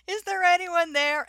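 high, loud tentative call-out; calling into a cave. Retold or imitated. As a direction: imitate her calling into the dark. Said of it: "and she goes".

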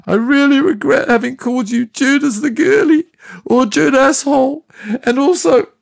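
high, loud gushing with joy; tearful joy. Retold or imitated. Jesus hugging Judas, gushing with tearful joy. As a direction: gush at your friend through happy tears. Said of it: "cried with joy and said".

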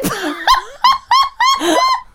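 long high-pitched laughing fit; high cackling laugh; helpless laughter. Real, not acted. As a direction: crack up mid-story. Cracks up reading the story.

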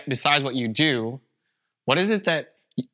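normal talking voice

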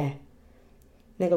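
usual speaking voice

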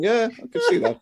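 high, knowing mock reply; high teasing aside; mock agreement. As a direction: tease him with a high sly voice. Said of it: "I was like".

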